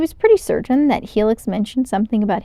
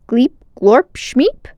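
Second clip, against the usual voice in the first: playful alien gibberish; made-up language; 4 semitones higher, 6 dB louder, much slower than usual. A narrator's reading. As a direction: chant the nonsense words brightly. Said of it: "speaking in his personal language".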